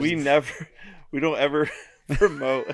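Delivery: laughs breaking through the words; giggling through a joke line; laughing. Real, not acted. Joking about never promoting.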